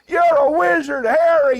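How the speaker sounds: high, loud, booming welcome; exuberant greeting. Acted. Host hails a guest who came back, exuberant greeting. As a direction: hail them with a big movie quote.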